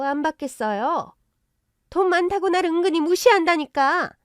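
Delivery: loud, high-pitched aggrieved rant; worked-up, shrill-tempered in-law; indignant mother-in-law. Acted. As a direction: complain at full volume about being slighted. In-law rants about being disrespected.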